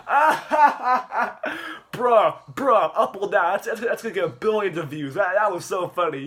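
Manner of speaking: loud, high put-on cackle into excited praise; acted laughing delight. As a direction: laugh big, then gush, imitating him.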